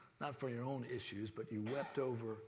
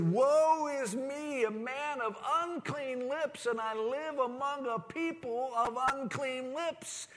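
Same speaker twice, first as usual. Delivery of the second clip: a prophet's lament, much higher and louder, stretched slow; anguished prophet crying out; 11 semitones higher, 9 dB louder, slower than usual. Acted. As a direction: cry out to God in despair as Isaiah. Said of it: "he was crying out to God".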